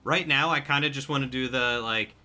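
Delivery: ordinary talk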